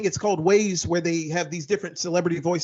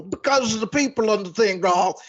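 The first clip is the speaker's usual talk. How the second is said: Bill Cosby impression; 3 semitones higher than usual. Comedian showing his old Cosby bit, playful impression. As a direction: do his old sitcom-dad voice. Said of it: "like, the Cosby impersonation".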